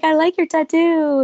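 high, put-on voice of Michael, teasing; high teasing impression of him; imitating Michael. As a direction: lift the voice up high to mimic him flirting. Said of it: "he was like".

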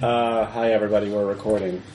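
low, underwhelmed greeting; unexcited. Real, not acted. Host telling everybody they're recording, unexcited. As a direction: announce it with no excitement at all. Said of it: "You sound so excited about it".

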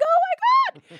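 very high-pitched gleeful shriek; ecstatic squeal. Retold or imitated. Woman retelling finding her dinosaurs still there, squealing with delight.

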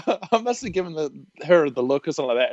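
usual speaking voice

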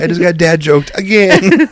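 high, loud mock exasperation; retold pissed-off outburst. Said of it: "He was so pissed off".

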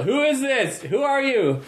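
put-on a clipped South African accent; Elon Musk South African accent. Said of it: "calls out in a distinctive South African accent".

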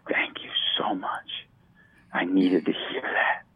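1 whispered thanks. Retold or imitated. Grateful stranger voiced softly.

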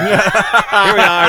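laughing. Acted. Dying Adams, laughing.

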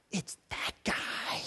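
breathy hush, barely voiced; whispered accusation. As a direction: whisper it like a secret finger-point. Lots silently pointing out Jonah.

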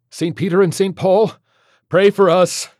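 high, loud invocation; urgent, forceful liturgical cry; shouted litany. Acted. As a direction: shout the prayer while fleeing. Exorcism litany while fleeing.

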